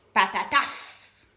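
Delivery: loud, slow, heavy "patataf"; imitated big splat. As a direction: mimic a cowpat slamming down. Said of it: "Io la faccio così". (tonfo pesante imitato)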